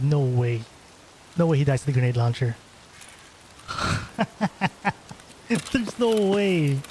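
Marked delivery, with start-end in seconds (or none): 3.7-5.1 short breathless laugh. Real, not acted. Enemy dies to grenade launcher. 5.5-6.8 slow, drawn-out, higher disbelief. Real, not acted. Disbelief at enemy's death.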